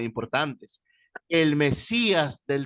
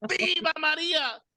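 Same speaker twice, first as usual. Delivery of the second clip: high, hammed-up old man shouting; shrill-pitched mock cry of devotion; retold old man's yell. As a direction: mimic a fanatic old man yelling in his face. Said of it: "me grita a la cara".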